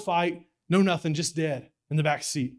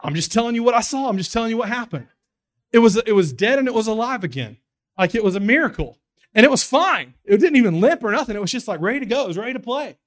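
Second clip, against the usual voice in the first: higher and louder, protesting it's true; amazed insistence; 8 semitones higher, 8 dB louder than usual. Real, not acted. Preacher telling the congregation about the revived dog, excited and high. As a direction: swear to the congregation you saw it.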